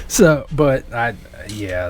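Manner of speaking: normal talking voice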